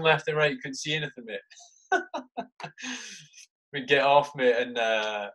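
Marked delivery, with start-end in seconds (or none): none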